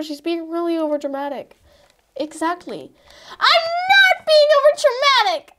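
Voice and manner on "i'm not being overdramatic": tearful protest. Acted. Cindy protests crying.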